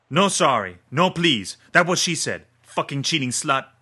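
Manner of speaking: high, loud raging yell; furious shout. Acted. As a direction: spit the insult out in fury. Gunman rages about dead girl.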